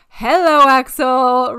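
loud, high call to a pet. Real, not acted. Host to her sneezing dog mid-recording, calling out.

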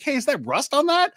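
quoted onlooker. Retold or imitated. Somebody spots rust on the game, high questioning. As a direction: lift the voice high as the nosy onlooker.

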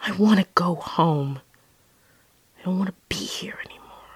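subdued plea, fading to a hush; tearful plea retold. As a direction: say it small, trailing into near-whisper. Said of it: "started crying".

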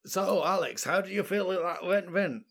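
lightly put-on Scottish interviewer; dodgy Scottish accent, barely there. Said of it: "That's my dodgy Scottish accent".

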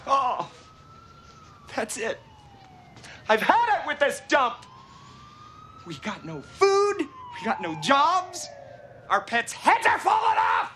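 exasperated rant. Retold or imitated. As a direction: complain like you've had it. Movie clip Lloyd ranting about dump.

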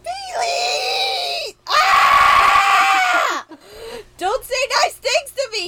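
high, loud hammed-up protest; mock outraged shout. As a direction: yell it in playful fake outrage.

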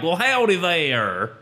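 stretched, slow twangy American South "howdy"; full-on Southern drawl. Acted. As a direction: lay on a big Southern US accent. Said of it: "are you full on like".

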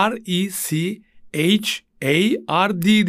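slow letter-by-letter spelling. Shown as a demonstration. Teacher spells Richard for students, slowly letter by letter. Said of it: "okuduğun zaman".

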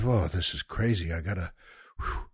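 low, soft aside to himself; overwhelmed private thought. Retold or imitated. Retelling his thought getting on the road, overwhelmed mutter. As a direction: mutter it under your breath, ending in a whew. Retold weary thought in the car.

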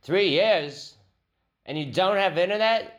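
high, incredulous mock British agent; put-on British disbelief; British accent. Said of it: "He was British".

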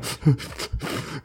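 soft, breath-only mock sobs; imitated sobbing. Showing how ex's mother sobbed while talking, imitated sobbing. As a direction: imitate quiet, hiccuping sobs. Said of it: "soluçando, né, ela, né?".

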